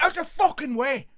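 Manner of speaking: high, loud, earnest command; forceful shout. Retold or imitated. Lads shoving past families in the queue, forceful shout. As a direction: order the crowd aside at full volume. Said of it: "like they meant it".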